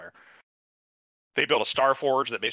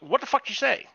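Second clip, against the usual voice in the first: incredulous; 14 semitones higher than usual. Retold or imitated. Incredulous quote to character.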